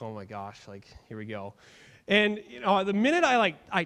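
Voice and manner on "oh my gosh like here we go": low, hushed, bracing for trouble; dreading quiet groan. Retold or imitated. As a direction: say it under your breath like here comes trouble. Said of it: "and I'm like".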